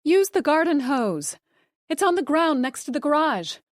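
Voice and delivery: high, loud call from the stairs; wife shouting a warning to her husband outside; shouted. A narrator's reading. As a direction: shout after him down to the garage. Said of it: "Joanna shouted after him".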